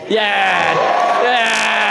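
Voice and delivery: high, drawn-out victory cheer; triumphant shout. Real, not acted. Groom hears bride say she called him, triumphant shout. Celebrates getting the answer he wanted.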